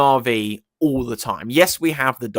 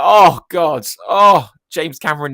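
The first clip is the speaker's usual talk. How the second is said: louder, higher "Oh, God" with feeling; overwhelmed exclaim; 4 semitones higher, 8 dB louder than usual. Retold or imitated. Fan reacting to James Cameron's scene, loud overwhelmed exclaim. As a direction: cry out as if the scene got to you. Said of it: "And I'm like".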